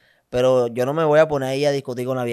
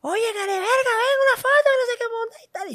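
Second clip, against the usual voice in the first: very high, rising shrieky aunt voice; tipsy aunt calling. Retold or imitated. Tipsy aunt calling him over for a photo, shrill mock. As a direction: call out like a drunk auntie. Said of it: "la tía".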